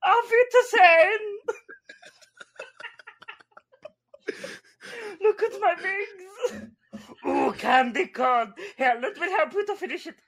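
high, loud mock German accent as Heimlich; hammed-up German accent, squeaked from on high. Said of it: "your Heimlich voice".

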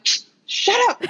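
high, mock frantic shush; hushed snap at daughter. Mother hushing her daughter as Justin passes, mock frantic. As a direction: shut her up fast so he won't hear. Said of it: "And I'm like".

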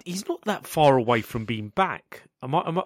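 normal talking voice

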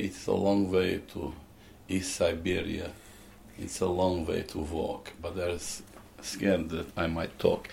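lightly imitated Russian accent, low and soft; a hint of a Russian accent, half sung. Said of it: "with a Russian accent".

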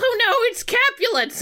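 very high mock panic; high-pitched cartoon seal in trouble; acted seal panic. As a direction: squeal in pretend dread. Acted alarm of the fleeing seal.

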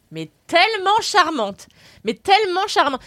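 tellement étiré, lent et haut, répété avec ravissement; admiration appuyée. Real, not acted. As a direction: insister sur tellement, émerveillée. Ravie de découvrir la ville. (elle vante la ville de Bouillon, ravie et appuyée)

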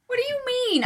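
high, offended question; indignant. Real, not acted. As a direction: react in high mock outrage. Indignant reply.

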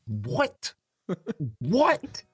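high, rising "What?" in mock disbelief; incredulous what. Dad recounting his reply about the bank money, incredulous. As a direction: repeat it, the second leaping up high. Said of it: "I'm like".

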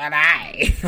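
attempted outback Aussie drawl; a poor stab at a menacing bushman's greeting; Mick Taylor. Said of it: "I can't do a Mick Taylor".

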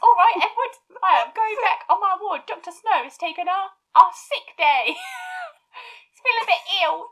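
put-on overdone chimney-sweep London accent. Doctor telling Edward he's off to the ward, mock cockney.

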